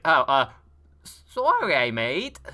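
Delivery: sheepish sorry in a badly faked British accent; terrible fake British accent. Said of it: "In a very terrible accent that's clearly fake".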